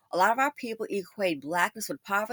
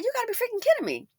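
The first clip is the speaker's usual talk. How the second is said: exasperated disbelief; 12 semitones higher, faster than usual. Real, not acted. Exasperated rant about the company.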